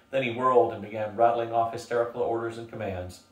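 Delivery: ordinary delivery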